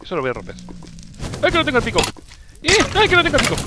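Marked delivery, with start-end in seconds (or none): 1.4-2.8 high, alarmed cry; high cry of dismay; alarmed wail. Real, not acted. Realizes he lost pickaxe. 2.9-3.5 high, louder wailing cry; loud high dismayed wail; louder alarmed wail. Real, not acted. Realizes he lost pickaxe.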